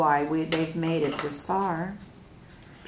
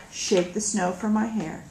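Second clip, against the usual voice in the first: ordinary delivery, nothing marked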